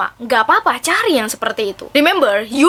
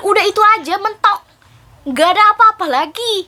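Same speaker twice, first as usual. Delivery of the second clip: ordinary delivery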